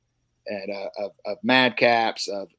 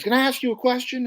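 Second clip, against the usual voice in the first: lady voice; 12 semitones higher than usual. Retold or imitated. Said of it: "she goes".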